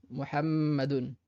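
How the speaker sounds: Muhammadun, bloquer sur le premier m; doubled m, held. Shown as a demonstration. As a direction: Muhammadun lentement, m tenu bouche fermée. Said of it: "on bloque un peu, un petit peu sur le premier mime". (Muhammadun en retenant le premier m)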